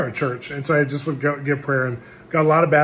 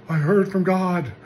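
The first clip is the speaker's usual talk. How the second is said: trembling announcement; 6 semitones higher, 3 dB louder, slower than usual. Retold or imitated. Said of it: "And he's shaking".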